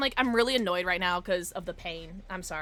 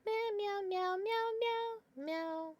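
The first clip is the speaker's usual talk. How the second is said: high, soft meowing; playful cat imitation; cat meows; 11 semitones higher, 6 dB quieter, much slower than usual. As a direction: call to the cat like a kitten.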